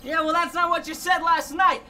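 loud, high-pitched retort; shouted banter. Acted. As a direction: holler it across the kitchen. Heated kitchen banter in background.

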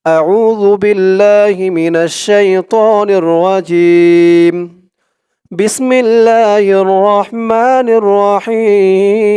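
slow, drawn-out chanted recitation; slow melodic Quranic chant. Acted. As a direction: intone it slowly like tilawat.

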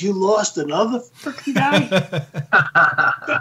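incredulous sergeant. Retold or imitated. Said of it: "He says".

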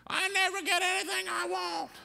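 put-on high-pitched whining toddler voice; whiny child. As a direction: whine it like a kid on the store floor. Kids throwing tantrum in store.

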